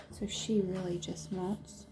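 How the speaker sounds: soft aside whisper. Acted. Narrator whispers an aside to the child listener. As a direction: tell it like a secret.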